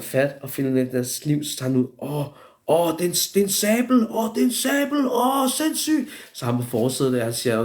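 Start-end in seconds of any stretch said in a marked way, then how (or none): 2.0-6.1 high-pitched excited exclamation, mimicked; hammed-up squealing amazement; mock astonishment. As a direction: exclaim like a stunned onlooker, far above your own pitch. Acted astonishment at finding a blade.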